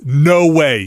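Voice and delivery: ordinary delivery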